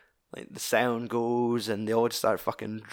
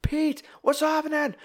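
mock panicked scream. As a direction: imitate a man freaking out in a high squeal. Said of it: "screaming like".

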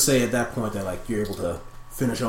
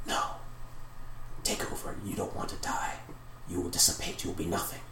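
urgent warning in a whisper; demonic whispers; 4 semitones lower, 10 dB quieter than usual. Acted. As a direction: whisper it as a menacing spirit's plea. Whispers answer the impression.

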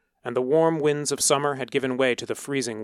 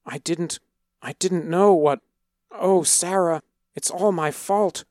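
higher, breaking plea; distraught crying; 7 semitones higher than usual. A narrator's reading. Boy cries to Hoika that it's his fault, distraught. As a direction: spill it out in guilt and grief. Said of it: "Kaviva cried to Hoika".

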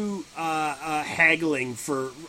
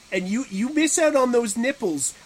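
raised, emphatic hustler pitch; pushy haggler; 5 semitones higher, 3 dB louder than usual. Acted. Agent selling the artist's famous nipples, hammed-up pushy pitch. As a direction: sell hard, mock-indignant.

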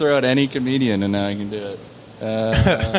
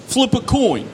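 raised-pitch brash comedian impression; higher-voiced comic impression; Andrew Dice Clay; 9 semitones higher, slower than usual. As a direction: do a caricature of a stand-up comic, pitched up. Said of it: "Do Andrew Dice Clay".

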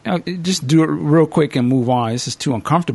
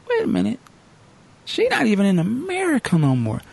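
high surprised; 7 semitones higher than usual. Retold or imitated. Realizing she's abroad.

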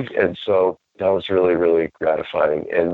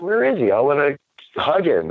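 pitched way up, eager to find him; bright high search for a friend; high, eager voice of a man wanting to hug his partner; high excited call; 10 semitones higher than usual. Retold or imitated. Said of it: "I'm like".

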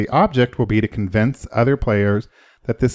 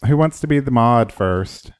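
normal talking voice

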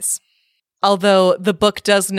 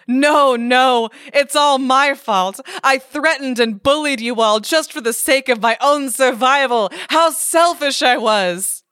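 higher, hammed-up lament; a mock-tearful troll dragging out regret; troll pretending to weep over his selfishness; mock sobbing troll; 6 semitones higher than usual. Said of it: "The troll begins to sob".

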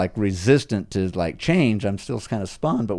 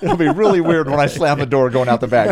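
laughing joke; 5 semitones higher, 7 dB louder than usual. Real, not acted. Joking about slamming the door.

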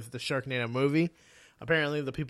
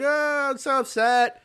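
mock upset; 9 semitones higher, 4 dB louder than usual. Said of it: "Veronica's like".

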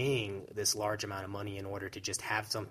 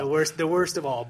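emphatic; 7 semitones higher, 11 dB louder than usual. Real, not acted. Repeats partner's words loudly.

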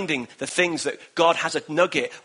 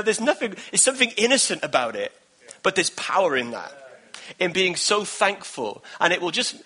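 ordinary delivery

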